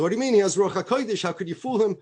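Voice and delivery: acted indignant questioning; incredulous objection. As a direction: object as if scandalised.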